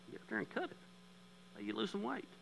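high, soft mock inner voice; mock inner grumble. Pastor voices his flesh's reply to crying neighbor, mock inner grumble. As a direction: voice the petty thought high and under your breath.

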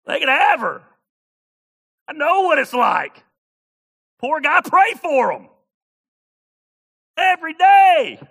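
high, very loud comic outburst; mock shouting. Preacher joking about those guys, shouting high and loud. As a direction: shout it high, in short separate bursts.

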